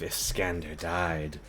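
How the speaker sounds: slow, soft hissing snake voice. Acted. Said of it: "I would stop doing the snake voice".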